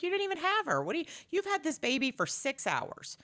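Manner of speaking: can't believe what you're hearing; mock incredulous.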